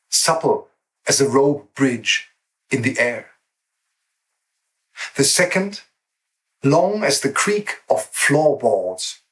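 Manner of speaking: slow, measured reading with long pauses; poem recital. Acted. Poet opening an online anthology presentation with a poem, measured recital. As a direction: let each short phrase hang before the next. Reads a short poem aloud.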